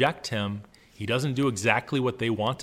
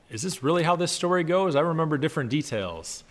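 higher-pitched mock doubt; voiced puzzled listener; 5 semitones higher, 3 dB louder than usual. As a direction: play a questioning audience member, pitched up. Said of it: "a lot of you are thinking".

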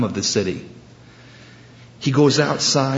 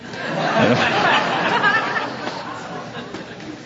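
laugh; 4 dB quieter than usual. Real, not acted. Congregation-style laugh after joke.

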